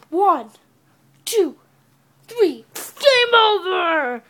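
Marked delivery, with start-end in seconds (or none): 2.7-4.2 slow, loud, higher game over; announcer shout. Pretend. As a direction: drag it out like an arcade announcer. Announcing end of match.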